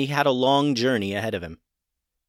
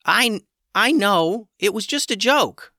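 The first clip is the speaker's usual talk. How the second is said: higher, slow embarrassed backpedal; flustered chicken; 10 semitones higher, 4 dB louder, slower than usual. Acted. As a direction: stammer out an excuse.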